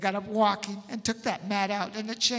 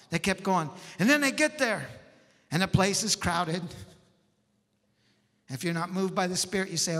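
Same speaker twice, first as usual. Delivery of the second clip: ordinary talk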